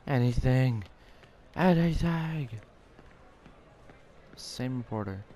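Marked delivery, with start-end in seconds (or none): 0.1-0.8 loud call into an empty search; loud, exasperated appeal. Real, not acted. Searching in vain, calling out. 1.6-2.5 loud, high-pitched mock plea; hammed-up, distorted holler; exaggerated calling out. Repeats the call, more exaggerated.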